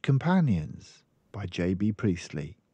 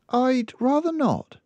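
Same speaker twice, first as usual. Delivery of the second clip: put-on much higher little girl voice; child explaining her mother's habits; small girl; 5 dB louder than usual. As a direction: speak like a matter-of-fact little girl. Girl declines.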